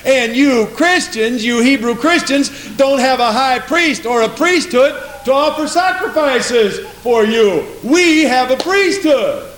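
high, drawn-out taunting; acted sing-out taunt, slow and high; mocking ridicule. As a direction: jeer at them like a rival boasting. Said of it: "mocked and ridiculed, laughed at, made fun of".

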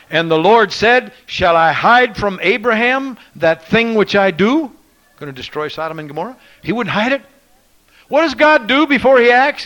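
high, loud ringing preaching; fiery revival preaching; preaching at full force. Acted. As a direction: call it out over the congregation.

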